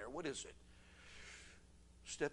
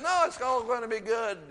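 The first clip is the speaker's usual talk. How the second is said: high, loud, emphatic reassurance; upbeat, high-pitched correction in an acted voice; encouraging inner voice; 11 semitones higher, 11 dB louder than usual. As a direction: lift it right up, cheering them on. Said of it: "It was encouraging".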